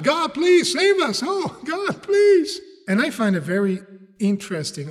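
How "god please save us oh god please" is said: acted high-pitched desperate plea; hammed-up pleading prayer; crying out to God. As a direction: beg to be rescued, voice pitched way up. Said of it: "crying out to God".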